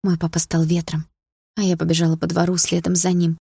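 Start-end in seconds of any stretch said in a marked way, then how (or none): none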